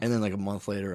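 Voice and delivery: ordinary talk, nothing marked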